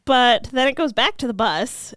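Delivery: usual speaking voice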